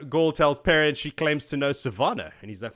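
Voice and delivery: ordinary talk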